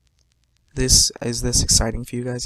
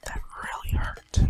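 soft unvoiced whisper; 4 dB quieter than usual. Shown as a demonstration. Gamer hurt but hiding it, whispered. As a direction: hush it flatly. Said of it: "I can't show any emotions, but I can tell you".

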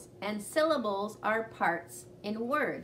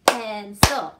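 loud "Pencil" in two beats; clapped syllables; 11 dB louder than usual. Shown as a demonstration. Teacher clapping a household word with pupils. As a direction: clap out "Pencil" with strong beats. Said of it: "Ready? Go".

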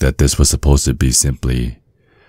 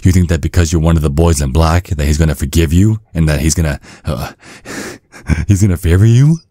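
ordinary delivery

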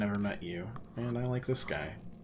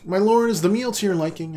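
servant voice; 10 semitones higher, 10 dB louder than usual. Acted. Voicing a servant at dinner.

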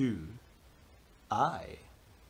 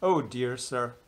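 ordinary delivery, nothing marked

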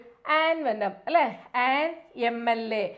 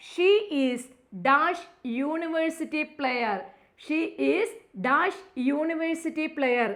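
slow read-aloud; 3 semitones higher, much slower than usual. Shown as a demonstration. Teacher reading the question to students. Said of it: "reads question slowly".

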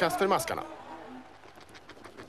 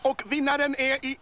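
high, rising commentator call; excited goal call; 6 semitones higher than usual. Acted. Commentator calls goal, final whistle. (upphetsad målrop)

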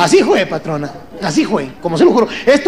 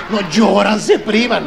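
ordinary talk, nothing marked

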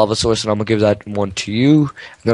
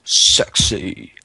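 mock sultry voice; 5 semitones lower than usual. Mock sexy voice.

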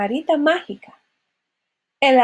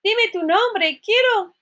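high, urgent cry; calling-out prince; 10 semitones higher, 3 dB louder than usual. A narrator's reading. Prince begging her name, high urgent call. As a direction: cry out, rising at the end. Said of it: "gritó el príncipe".

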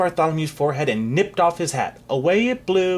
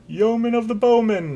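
chorus echo; 9 semitones higher than usual. Acted. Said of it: "echoed all the lords".

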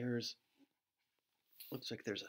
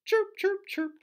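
very high chirping; robin chirp; 9 dB louder than usual. Shown as a demonstration. Teacher chirping like a robin, very high. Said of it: "Can you chirp like a robin?".

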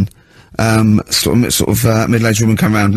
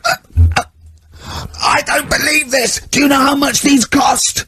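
put-on high, slow, shouted rage of an old teacher; furious teacher rant. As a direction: scream it out like a teacher losing it completely. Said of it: "he went mental".

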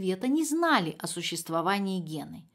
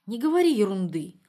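brushing off; 6 dB louder than usual. A narrator's reading. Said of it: "отмахнулась Света".

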